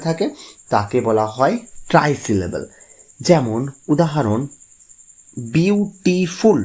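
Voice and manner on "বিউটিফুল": বিউটিফুল slow and high, in three beats: বিউ, টি, ফুল; high, measured বিউ-টি-ফুল for বিউটিফুল; three separate syllables. Shown as a demonstration. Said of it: "তিনবার কিন্তু জিভটা বাধা পাচ্ছে".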